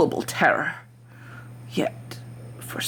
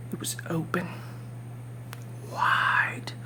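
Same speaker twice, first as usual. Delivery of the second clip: hushed whisper; 7 dB quieter than usual. A narrator's reading. Narrator staring at the old man's eye, whispering. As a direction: breathe it out as if afraid to wake him.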